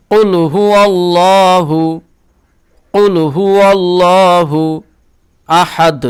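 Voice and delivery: teacher models the verse; tajweed recitation. Shown as a demonstration. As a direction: recite it slowly with a full voice. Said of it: "আর এই হাটা গলার মধ্যখান থেকে বের করবেন".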